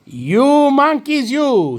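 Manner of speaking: high, loud, drawn-out storybook scolding; hammed-up indignant peddler. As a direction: scold the monkeys with long, stretched vowels. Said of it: "This made the peddler angry".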